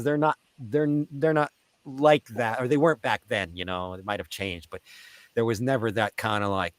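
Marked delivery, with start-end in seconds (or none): none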